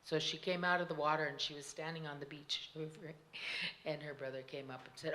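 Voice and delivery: ordinary delivery, nothing marked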